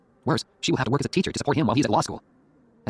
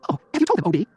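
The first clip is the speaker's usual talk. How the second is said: high, louder mother's question; 9 semitones higher, 6 dB louder than usual. Acted. Mother voiced at the dinner.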